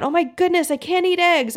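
high-pitched mock panic; freaking out. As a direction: squeal it like a brain in sudden panic. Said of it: "your brain starts freaking out".

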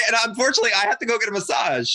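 bursting with a joke; mock announcement. Joking about leaving for massage.

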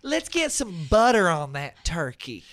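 slow hammed-up Southern drawl; Southern accent. Showing Tara he can do Southern, slow drawl. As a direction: ham up a Southern cook. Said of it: "do a Southern accent".

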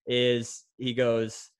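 usual speaking voice

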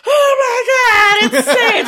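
high, drawn-out, loud squeal; freaking-out fans. Retold or imitated. Kids spot pop star Sage at school, mock fan freak-out. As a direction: imitate starstruck kids screaming in excitement. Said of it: "they just start freaking out".

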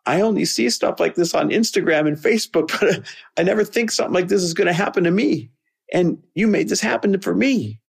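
high, teary voice imitating a man sobbing; retold sobbing. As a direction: let the voice climb high and crack like he's crying through it. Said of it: "in between his sobbing".

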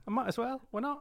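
high, hammed-up British accent; put-on British accent. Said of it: "it's not our Scottish".

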